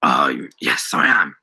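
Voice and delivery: very low voice, grinning; grinning bluff. A narrator's reading. Daniel assures the shopkeeper he is English, grinning. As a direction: agree through a grin. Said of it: "Daniel grinned".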